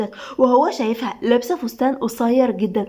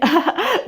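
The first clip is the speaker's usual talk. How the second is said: high, rising giggle; acted laugh climbing in pitch; 6 semitones higher than usual. A narrator's reading. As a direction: burst into a short high laugh. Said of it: "دعاء بضحكة عالية".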